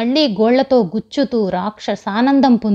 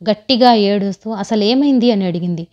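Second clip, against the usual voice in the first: plain reading voice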